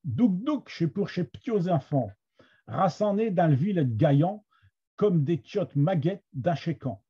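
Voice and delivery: reading Picard verse in a Somme accent. Shown as a demonstration. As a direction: recite the line with a Picard accent, measured phrasing. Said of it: "avec, euh, mon accent de la Somme". (accent picard de la Somme)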